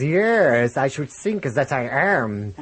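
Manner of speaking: mummy with a hammed-up German accent, loud and slow; drawn-out mock-German mummy voice. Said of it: "speaking with a German accent".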